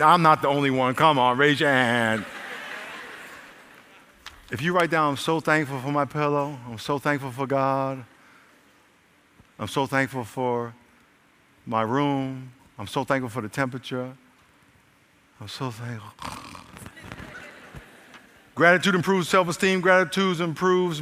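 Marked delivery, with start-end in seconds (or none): 5.1-16.1 soft, low, slowing murmur; sleepy thanks, sinking lower and softer; drifting off to sleep. Acted. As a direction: fade away as if nodding off mid-list.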